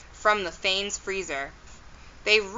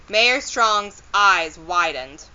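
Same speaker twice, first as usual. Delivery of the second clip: ordinary delivery, nothing marked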